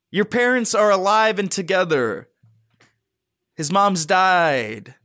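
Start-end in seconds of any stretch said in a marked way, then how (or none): 3.5-4.9 very slow, grave announcement; slow mock-solemn reveal. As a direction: drop the news slowly and gravely.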